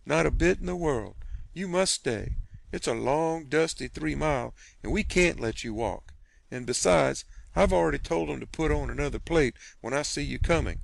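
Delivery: fussing country aunt who won't take no; insistent hospitable aunt. Acted. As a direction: sweep him inside with kindness. Aunt Sally insisting he stay.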